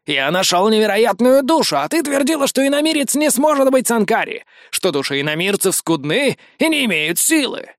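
lively, triumphant god voice; boastful young god. Acted. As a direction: crow about a great find.

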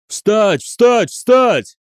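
high, loud, mounting repeated cry; yelled command. A narrator's reading. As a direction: drive the soldiers up with shouts. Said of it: "вопил Дев". (орать приказ всё громче)

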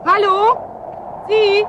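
loud high call into the distance; calling out loud and high, searching. Acted. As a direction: shout into the storm for anyone. Calling out in snowstorm.

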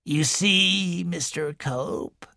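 put-on sly smiling old woman telling the visitor; strange smile. A narrator's reading. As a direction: smile oddly as the wheezing matriarch. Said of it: "with a strange smile".

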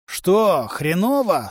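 usual speaking voice

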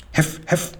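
two high, airy yaps; little dog barking in words; yapping dog. A narrator's reading. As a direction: yap it like an excited dog. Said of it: "kläffte Waski".

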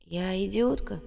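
soft, slow, stunned question; hushed disbelief; dazed echo. A narrator's reading. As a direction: echo the insult faintly, slowly. Dazed girl echoes the insult.

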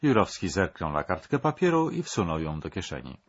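ordinary delivery

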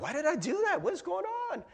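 high-pitched, bewildered exclamation; acted dismay in a squeaky-high pitch; dismayed self-questioning. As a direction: shoot up high, baffled at yourself. Acted dismay after yelling at family.

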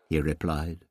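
ordinary delivery

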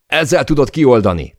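loud, high-pitched instruction; urgent shouted instruction. A narrator's reading. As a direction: call it out urgently to a crewmate. Yelled instruction while falling. (sürgetően, hangosan odakiáltva)

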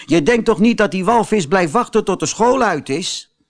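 put-on high, scoffing boy voice; mocking schoolboy. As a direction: laugh at him for being so good. Boys mock Pinocchio.

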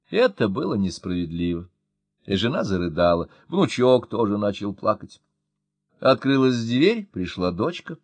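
ordinary delivery, nothing marked

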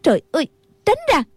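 high, fast shouted order; a nun losing patience; sharp yell. A narrator's reading. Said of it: "rồi quát lên".

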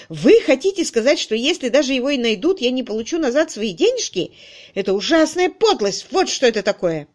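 indignant outburst. A narrator's reading. Crutchley's outburst continues.

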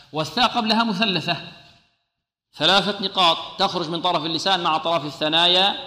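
ordinary delivery

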